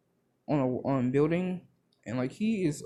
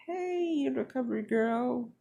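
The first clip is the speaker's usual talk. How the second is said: put-on much higher, squeaky boyish call; squeaky young Deku; 10 semitones higher than usual. Deku calling to Recovery Girl, in a squeaky high voice. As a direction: pipe it up high like a startled kid.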